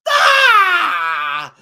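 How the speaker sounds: high, loud mock attack scream; battle scream. Little sister attacking the villain, battle scream. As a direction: scream like charging with a chainsaw.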